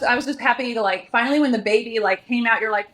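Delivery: ordinary delivery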